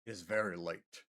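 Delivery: deadpan dude delivery; straight guy voice. Real, not acted. Answering chat in a low voice a viewer calls a straight man.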